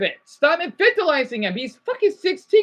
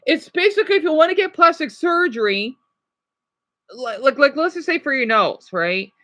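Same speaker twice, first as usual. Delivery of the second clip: ordinary talk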